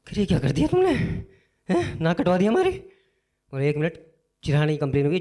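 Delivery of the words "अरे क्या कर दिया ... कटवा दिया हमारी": higher, louder shocked scolding; mock outraged family, higher and louder; scolding parent. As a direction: berate the son like an outraged parent.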